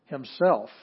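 himself hit loud and falling; stress on himself. Shown as a demonstration. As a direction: punch the word himself. Teacher stressing emphatic pronoun.

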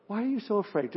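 softly put, unhurried question; soft and composed amid panic; very calm. Acted. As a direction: ask quietly, with no alarm. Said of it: "He said that very calmly".

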